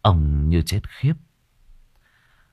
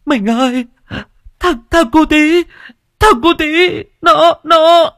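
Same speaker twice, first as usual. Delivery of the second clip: sobbing, much higher and louder, choking on the words; 7 dB louder than usual. Acted. Wife to husband, sobbing out that their son died. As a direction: wail it out through sobs, voice breaking. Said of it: "vừa khóc vừa nói trong tiếng nấc nghẹn ngào".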